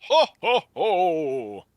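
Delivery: high, loud hearty ho ho ho; classic Santa laugh. Shown as a demonstration. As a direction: bellow a jolly Santa laugh. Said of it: "[name], top it".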